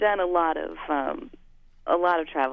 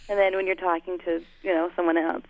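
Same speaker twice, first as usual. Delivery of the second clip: usual speaking voice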